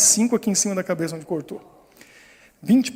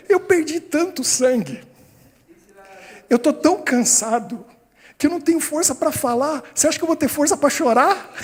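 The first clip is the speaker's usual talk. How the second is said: indignant retort; 6 semitones higher than usual. Retold or imitated. Exhausted boy answering nurse in his head, indignant. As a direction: protest like a worn-out kid, hammed-up.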